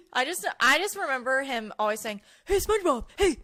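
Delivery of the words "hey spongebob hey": high, loud goofy Patrick; Patrick impression. Cohost does Patrick calling SpongeBob, goofy high. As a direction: call out dopey and eager. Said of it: "I also put Patrick".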